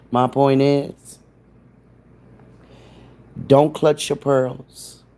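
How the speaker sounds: each word slowed and leaned on, "don't clutch your pearls"; slow emphatic warning. Real, not acted. Telling offended viewers to relax, slow and emphatic. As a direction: lay it down slow so "pearls" lands. Scolding viewers about her thumbnail.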